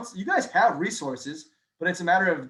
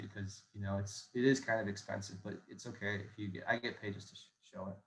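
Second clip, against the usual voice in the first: trailing, half-hearted sales pitch fading to a near mumble; unenthusiastic mumble; 6 semitones lower, 7 dB quieter than usual. Shown as a demonstration. Salesman mumbling a pitch he doesn't believe in, fading. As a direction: sell it like you don't believe in it, voice sinking. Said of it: "if that's how you talk about it".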